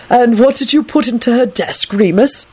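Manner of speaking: high-pitched, mild sternness; stern. A narrator's reading. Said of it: "Dumbledore asked him sternly".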